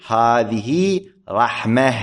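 slow demo of pausal "رحمة"; pausal ha ending. Shown as a demonstration. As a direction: say "رحمة" stopping on ha, not t. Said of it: "تقول".

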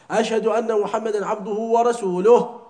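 ورسوله said fully, warasuluh, the final ha sounded; loud correct recitation. Shown as a demonstration. Teacher modelling the shahada the right way, loudly. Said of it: "تظهر الهاء".

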